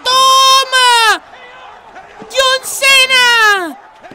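loud, high, stretched meme shout; drawn-out wrestling intro yell; mock announcer yell. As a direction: bellow it like a ring announcer. Meme shout after kill.

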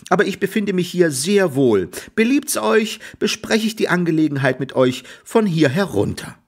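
put-on high teasing boy voice; mocking Jack. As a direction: talk down sweetly from the tree. Jack mocks from tree.

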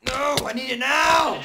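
high, loud, slowed demand; urgent yelling. Acted. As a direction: insist loudly it's needed now. Demanding wheat.

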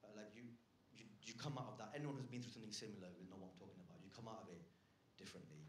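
low, hushed, grave delivery; mock-sombre confession. Comedian confiding a pandemic loss, mock-sombre and quiet. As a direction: confide a loss softly, setting up a joke. Sombre fake-out story of losing someone.

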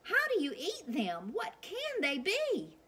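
high, astonished townsfolk crying out; puzzled cry. Acted. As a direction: squeak up in bewilderment. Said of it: "cried the townspeople".

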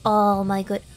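normal talking voice

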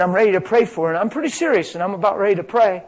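usual speaking voice

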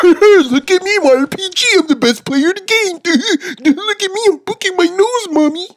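high, laughing taunt of a whiny nerd; mock a nerd bragging and laughing. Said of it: "this freaking nerd with his R P G".

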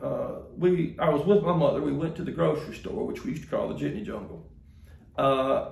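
ordinary talk

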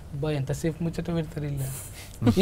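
low, soft grumble under the breath; subdued, lightly acted grumbling; muttered mock complaint. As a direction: imitate someone quietly fed up with long chatter. Mimics a bored listener's complaint.

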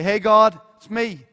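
casual greeting. Retold or imitated. A casual churchgoer hailing God, loud and breezy. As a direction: breeze in like God's your mate. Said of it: "show up someday and say".

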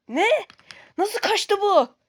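high, loud disbelief; can't believe it got away; shocked. Acted. Target escapes unexpectedly. (yüksek sesle şaşkınlıkla)

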